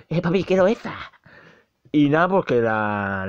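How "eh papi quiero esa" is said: put-on high little-kid voice, begging dad; baby voice. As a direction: play a small child wanting it. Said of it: "Así con voz de bebé".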